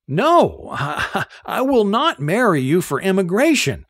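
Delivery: loud protest breaking into a pained laugh; too loud, pained laugh. Acted. Narrator turning down a marriage proposal over dinner, too loud with a pained laugh. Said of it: "too loud and with a pained laugh".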